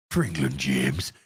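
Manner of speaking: very low, hushed Yorkshireman trying to speak posh; deep, quiet Yorkshire actor's impression; Sean Bean. Streamer answering a viewer's request, deep Sean Bean impression. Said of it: "that Yorkshire trying to speak posh".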